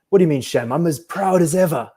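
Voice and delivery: put-on indignant young prince. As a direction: protest hotly, pride wounded. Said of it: "And then Zuko goes".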